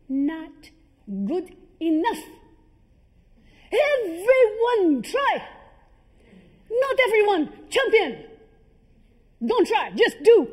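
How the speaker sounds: very loud, high, forceful shout; coach yelling. Retold or imitated. Coach to a tiring student in a kicking drill, yelling. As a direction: bellow each short command, punching every word. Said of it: "he would yell at me".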